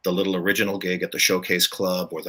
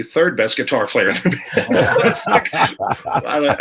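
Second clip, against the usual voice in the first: laughing; 6 semitones higher, 5 dB louder, slower than usual. Real, not acted. Laughs at his own joke.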